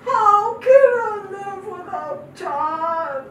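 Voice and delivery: loud anguished cry to God; a widow calls out to God in her grief; retold crying out. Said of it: "I cried out and I said".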